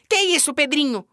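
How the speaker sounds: high cheeky doll voice; Emília doll voice. Acted. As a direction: play the cheeky rag doll. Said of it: "disse a boneca".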